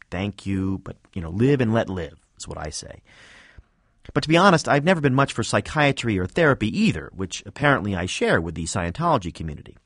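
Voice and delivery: ordinary delivery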